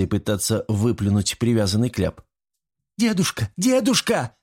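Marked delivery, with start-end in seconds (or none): 3.0-3.5 high, anguished call; desperate cry. Acted. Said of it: "раздался крик Эбби". 3.6-4.3 desperate scream. Acted. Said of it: "раздался крик Эбби".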